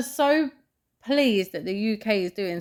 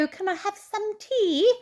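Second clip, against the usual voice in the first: higher, prissy fake-posh British voice as Americans imagine it; mock posh British accent; 10 semitones higher than usual. Mocking Americans' idea of British speech, in a higher mock-posh British accent. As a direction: mimic how Americans think the British sound. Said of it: "they go".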